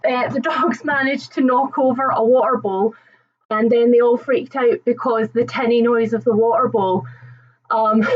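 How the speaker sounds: ordinary talk, nothing marked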